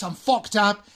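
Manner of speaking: "fucked up" run together as fuck-tup; linked T. Shown as a demonstration. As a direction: link the T of "fucked" onto "up". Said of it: "la gracia es la T de fucked". ("fucked up" enlazando la T con "up")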